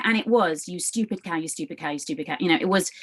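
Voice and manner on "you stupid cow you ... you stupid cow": softer, repetitive self-berating, imitated; inner attacking voice. Her inner voice attacking her on repeat, quietly berating. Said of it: "it was really attacking me".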